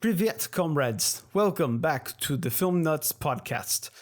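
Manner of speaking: put-on slow, high Russian accent. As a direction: ham up a Russian host. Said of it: "I thought I could do a Russian accent".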